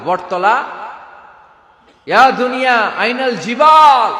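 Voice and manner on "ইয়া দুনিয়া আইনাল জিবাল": loud, high ringing call to the earth; a thundering summons; booming call. Acted. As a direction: call out across the whole world. God calling out to the world.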